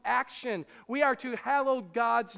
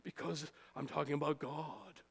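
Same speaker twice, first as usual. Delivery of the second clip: mock-reverent, lower pitch; holy voice going down; 7 semitones lower, 9 dB quieter than usual. Pharisee answering why his voice drops, mock-solemn and lower. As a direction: drop into a solemn churchy voice. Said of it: "Why does your voice have to go down?".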